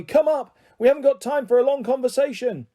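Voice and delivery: high sharp call of the name; shouting. Acted. Charlie shouting to his son on the ladder, urgent. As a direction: snap his name out to get attention. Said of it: "shouted Charlie".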